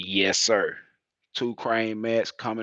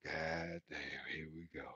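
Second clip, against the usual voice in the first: much softer, lower resigned mutter; muttered dread; 4 semitones lower, 15 dB quieter than usual. Retold or imitated. Driver dreads the scale split, much softer and lower. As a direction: groan it under your breath. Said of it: "I said".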